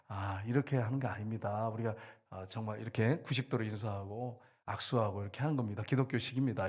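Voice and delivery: low, soft mock instruction; hushed, low-pitched correcting voice; gentle correction. Acts out correcting a newcomer's greeting.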